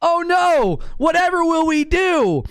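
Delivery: mock dismay. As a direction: wail "oh no" like a fake tragedy. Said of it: "I'm enjoying the sarcasm".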